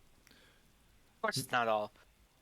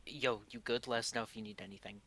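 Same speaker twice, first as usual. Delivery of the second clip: quiet telepathic aside to a friend; unobtrusive mind link; 2 semitones lower, 8 dB quieter than usual. Acted. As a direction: keep it hushed so you don't distract. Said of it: "just trying not to be distracting".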